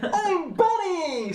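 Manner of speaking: very high, slow robot dog voice; high-pitched cartoon robot pup; Buddy. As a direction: chirp a proud, stretched-out introduction. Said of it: "says, says the dog".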